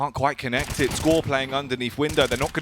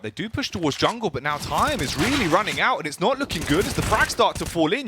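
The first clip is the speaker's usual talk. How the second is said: esports caster; 8 semitones higher, 3 dB louder than usual. Real, not acted. Rising live play-by-play.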